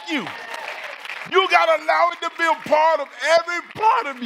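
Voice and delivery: high, loud, driving preacher delivery; loud exhortation, pitched high; full-force preaching. Real, not acted. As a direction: preach it out at the top of your range.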